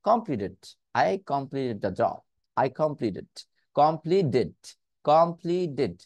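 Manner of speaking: কমপ্লিটেড said "কমপ্লিডেড"; flapped t as d. Shown as a demonstration. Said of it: "ডিটাকে আবার নিয়ে আসতে পারতেছি". (কমপ্লিটেড-এ টি কে ডি)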